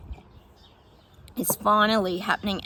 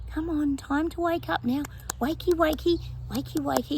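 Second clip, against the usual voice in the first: higher, coaxing wake-up call; little inner voice; 7 semitones higher than usual. Retold or imitated. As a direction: gently coax someone out of sleep. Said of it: "this little voice in their head going".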